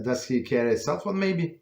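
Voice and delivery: ordinary delivery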